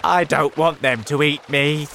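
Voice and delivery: loud, high wail; fearful cry. A narrator's reading. Earthworm dreading the sharks, fearful cry. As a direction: wail loudly in self-pity. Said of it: "cried the earthworm".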